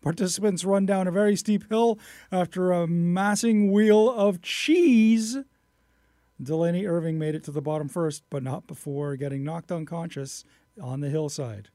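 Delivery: loud, lifted headline easing into the details; lively news reader. Acted. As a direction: punch the opening like a radio news story. Reads a news story.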